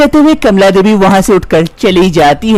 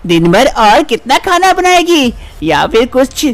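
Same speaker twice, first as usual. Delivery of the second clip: higher-pitched, taunting mother-in-law; nagging mother-in-law; 9 semitones higher than usual. Acted. Mother-in-law threatening her childless daughter-in-law, higher taunting voice. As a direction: needle the daughter-in-law as a scolding saas.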